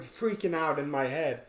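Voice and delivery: ordinary talk, nothing marked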